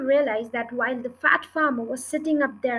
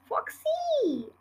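excited greeting; 14 semitones higher than usual. A narrator's reading. Badger greets fox joyfully.

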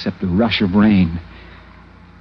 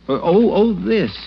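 high startled stammer over the parcel; nervous tourist caught out, suddenly high and flustered; flustered surprise; 8 semitones higher, 3 dB louder than usual. Acted. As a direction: caught off guard, fumble the words. Startled when asked about parcel.

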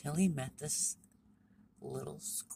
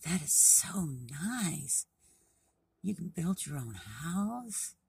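slow, drawn-out admiration; fascinated little frog; 4 semitones higher, 5 dB louder, slower than usual. A narrator's reading. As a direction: stretch it out like an impressed storybook frog. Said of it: "Little Frog was so interested".

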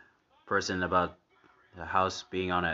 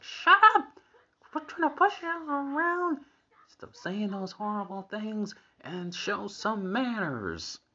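Marge losing it; 3 dB louder, slower than usual. Retold or imitated. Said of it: "Marge finally loses it right here".

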